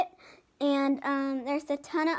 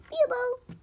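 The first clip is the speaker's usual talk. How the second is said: tiny hippo voice; 10 semitones higher than usual. Acted. Hippo calling her belly button.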